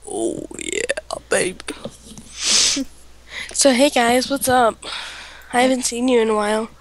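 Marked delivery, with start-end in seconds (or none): 0.1-1.6 low whispered tease; hammed-up seduction; mock seductive.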